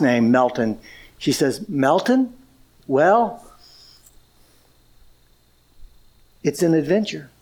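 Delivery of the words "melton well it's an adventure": gentle reassurance. Retold or imitated. Wife gently reassures crying husband.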